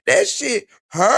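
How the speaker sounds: high, slow fake whimper; imitating a tearful complaint; mock crying. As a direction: whine it like a crybaby mocking her. Mocking a crying woman's video.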